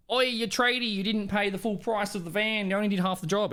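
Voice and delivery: high-pitched imitation of a neighbourhood complainer; hammed-up high-pitched accusation; mock accusing. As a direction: call someone out in a high mock voice. Acts out a neighbourhood beef accusation.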